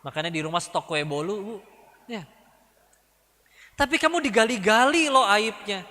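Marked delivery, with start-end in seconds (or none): 3.7-5.8 high, insistent stirring-up; egging on. Acted. Friend warns the victim their shame is dug up, goading. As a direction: provoke them with a raised, pressing pitch.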